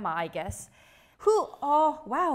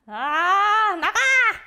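loud high mock scold through laughter; 웃으며 흉내 내는 할머니의 호통; grumpy grandma shout; 7 semitones higher, 9 dB louder than usual. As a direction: shoo them out like an embarrassed grandma. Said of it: "Don't embarrass your grandmothers".

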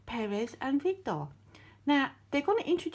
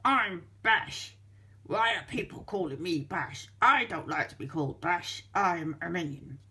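lower, sulky minion who hates his name; low grumpy minion; 6 semitones lower than usual. Acted. Third minion sulking to the narrator about being called Bash. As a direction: grumble it like a cross little minion.

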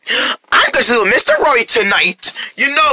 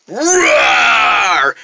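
dinosaur roar. Acted.

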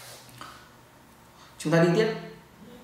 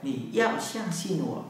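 slow demo; 4 dB quieter, slower than usual. Shown as a demonstration.

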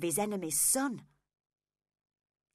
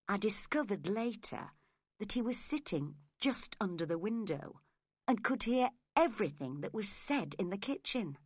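plain reading voice

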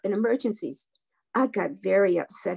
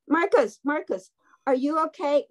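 high, urgent call of a name; story voice calling to rouse someone; worried calling out; 6 semitones higher than usual. A narrator's reading. Damien checks on friend who was shaking, worried calling. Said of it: "Why was he yelling my name?".

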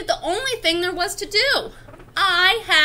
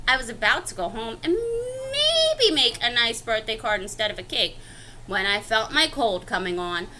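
plain reading voice

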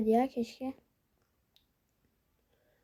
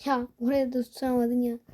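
normal talking voice

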